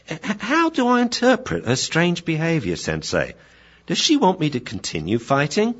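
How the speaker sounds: high, earnest questioning; puzzled young pupil. Acted. Puzzled dog asking Sensei about owner's behaviour, high earnest voice. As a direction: ask the master, baffled. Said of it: "see what Grasshopper has to say".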